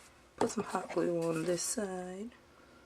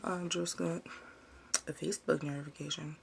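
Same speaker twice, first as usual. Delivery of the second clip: normal talking voice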